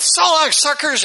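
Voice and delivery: high-pitched mock yell; retold yell. Boys yelling as they take off in the car, mock yell.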